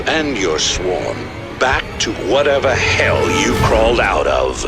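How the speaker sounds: menacing threat. Acted. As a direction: threaten the invader coldly. Emperor threatens the invading queen.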